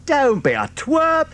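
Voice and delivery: high, slow mock scold; scolding fox. A narrator's reading. Mister Fox to his son, high playful scolding. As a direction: tease him fondly. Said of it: "said Mister Fox".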